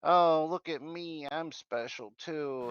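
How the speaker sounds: high, drawn-out sing-along whine; mock attention-seeking. Speaker mimics people wanting sympathy points, mock attention-seeking. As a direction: mockingly plead for attention, slowly. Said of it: "one of these people that just wanted".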